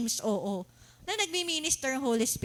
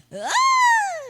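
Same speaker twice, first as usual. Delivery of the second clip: mock euphoric cry; 7 dB louder than usual. Imitating high feeling.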